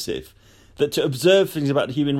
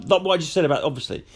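ordinary talk, nothing marked

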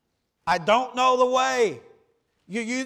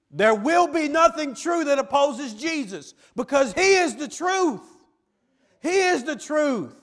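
higher and louder, building to "truth"; louder third time; 6 semitones higher, 4 dB louder than usual. Shown as a demonstration. Preacher hammering it home to the doubters, louder. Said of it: "one more time for those".

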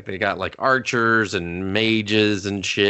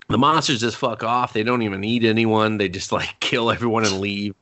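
normal talking voice

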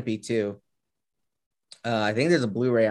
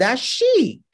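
high, loud hammy Italian; mock Italian accent; 12 semitones higher, 5 dB louder than usual. Reacting as the film character would, hammed-up Italian accent. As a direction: exclaim it in cartoon Italian. Repeats the mock Gucci line.

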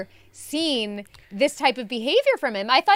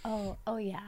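imitated boy; 2 semitones lower, 13 dB quieter than usual. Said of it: "And he'd be like".

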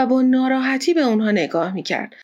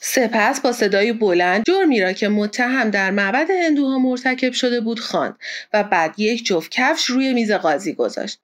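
ordinary delivery

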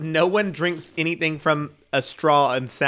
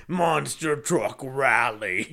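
slow, booming announcer hype; monster truck ad; much slower than usual. Acted. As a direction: drag it out like a radio promo.

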